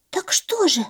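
high whispered chick voice; whispering chick. Acted. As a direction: breathe it like a tiny chick.

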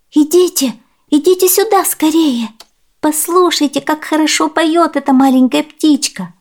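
put-on high, eager girl's call; excited exclaiming. A narrator's reading. Girl calls father and stepmother to hear the bird, high excited exclaiming. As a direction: call them over urgently, pitched well up. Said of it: "воскликнула Урсулета".

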